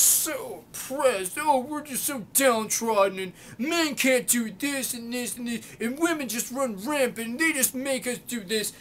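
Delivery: high, drawn-out whiny mockery; milk every word like an exaggerated victim; mock self-pity. Vlogger parodies men claiming they are oppressed, high mock lament.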